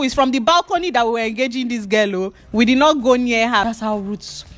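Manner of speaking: ordinary talk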